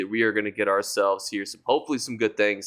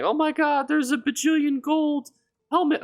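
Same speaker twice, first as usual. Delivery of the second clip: very high-pitched mock hype voice; put-on excited squeal. YouTuber mimicking his own hype video about a gold helmet, put-on high squeal. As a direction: squeal it in a silly, much higher voice. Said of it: "made my voice sound like this".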